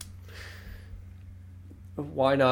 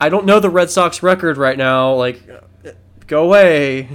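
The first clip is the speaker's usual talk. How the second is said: loud, higher, fed-up husband act; mock exasperation; 5 semitones higher, 10 dB louder than usual. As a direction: wave her off in playful exasperation. Husband sends accented wife away.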